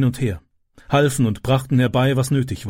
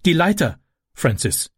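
mildly raised urgent call; 4 semitones higher than usual. A narrator's reading. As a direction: call to the son, starting high and dropping. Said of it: "rief sie".

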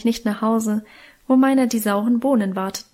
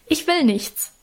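sobbing; 5 semitones higher than usual. A narrator's reading. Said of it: "schluchzte ich los".

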